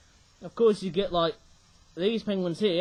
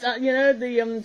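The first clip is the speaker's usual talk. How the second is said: usual speaking voice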